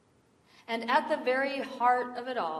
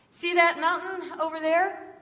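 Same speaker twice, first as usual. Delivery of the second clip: high, louder storyteller's opening; raised, eager folksy voice; talkative stranger; 7 semitones higher, 5 dB louder than usual. Retold or imitated. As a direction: draw the listener's eye to something far away. Stranger starts his story.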